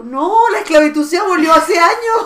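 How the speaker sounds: mock outrage at the butler; retelling her protest to the butler, half laughing; indignant protest.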